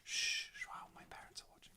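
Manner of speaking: playful whisper, barely any voice; mock hush. Co-host shushing friend because parents are watching, in a mock whisper.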